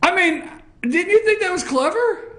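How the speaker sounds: high teasing plea; playful pleading. Real, not acted. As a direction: wheedle her for a yes. Host pleading for agreement.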